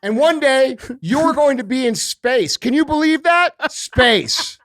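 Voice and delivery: slow, drawn-out mock politician; mocking speech with heavy, deliberate emphasis; Kamala impression. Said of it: "another woman who talks like this".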